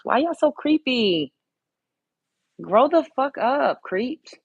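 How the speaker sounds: high, loud and slow, telling off the chat; fed up, scolding the creeps; angry scolding. Real, not acted. Streamer scolding creepy men in chat, high angry shout.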